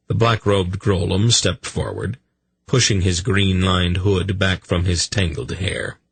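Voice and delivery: plain reading voice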